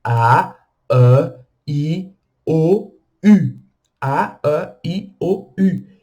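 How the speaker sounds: vowels A, I, O, U said crisply; French vowels. Shown as a demonstration. Teacher recites the French vowels for practice. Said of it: "o A e o U são muito fechados".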